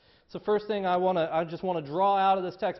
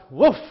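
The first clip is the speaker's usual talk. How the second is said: mock dog woof; 3 semitones higher, 14 dB louder than usual. Dog answers butcher in joke.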